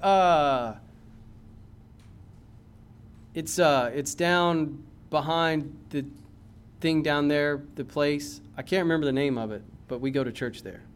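halting, low, mock-unsure churchgoer; hesitant stumped answer. Churchgoer asked where he goes to church, acted as hesitant.

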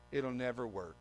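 low, quiet put-down; imitating a glum doubter; dismissive naysayer. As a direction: pour cold water on it. Naysayers answer an excited plan.

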